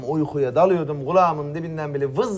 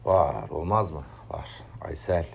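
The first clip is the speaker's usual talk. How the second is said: lower, soft and unvoiced; tender whisper; 11 semitones lower, 6 dB quieter than usual. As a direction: breathe her name like a secret love. Asked about someone he loves. (sevgiyle fısıldayarak)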